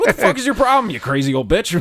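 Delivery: high, loud re-enacted tirade; retold angry yelling. Storyteller berating an old lady in the store, mock-angry yell. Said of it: "I start yelling at this old lady".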